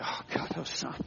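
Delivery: whispered, shaken relief; father whispering as his son walks out of the water; breathless relief. Retold or imitated. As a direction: breathe it out almost voiceless. Father sees son come ashore.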